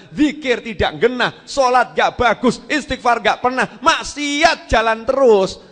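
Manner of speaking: loud, high, emphatic rebuke; exasperated scolding. Real, not acted. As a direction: hammer out each reproach in exasperation. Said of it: "Ya Allah gregeten".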